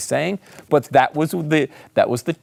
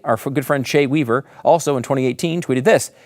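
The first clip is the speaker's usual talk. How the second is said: ordinary delivery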